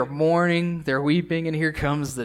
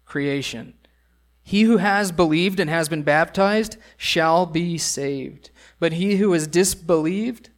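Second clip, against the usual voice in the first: scripture reading; 2 semitones higher, 3 dB louder than usual. Acted. Pastor reads Jesus' commission to the congregation, solemn. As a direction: proclaim the words of Jesus from the pulpit. Reads Mark sixteen aloud.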